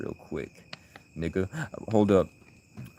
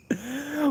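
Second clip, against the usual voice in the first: high laugh; 5 dB quieter than usual. Real, not acted. Laughs at racist chat.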